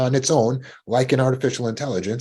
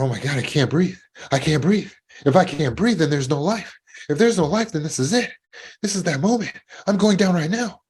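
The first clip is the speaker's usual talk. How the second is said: higher and higher, panicky gasping thoughts; rising panic; 7 semitones higher than usual. Retold or imitated. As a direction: spiral up into breathless panic. Acting out panicked inner voice.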